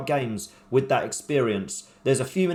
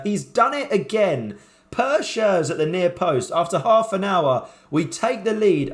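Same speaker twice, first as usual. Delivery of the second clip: higher, drawn-out elated goal call; excited goal call; 5 semitones higher, 3 dB louder, slower than usual. Real, not acted. Commentator calling his team's goal, elated and slowed. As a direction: savour the goal, stretching every word.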